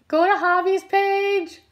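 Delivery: high drawn-out insistence; high insistent urging. Real, not acted. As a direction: go up high and slow, pressing. Urging friend, repeated insistently.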